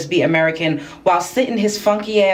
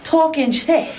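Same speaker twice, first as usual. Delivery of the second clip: excited greeting; 6 semitones higher than usual. Pretend. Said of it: "I would be like".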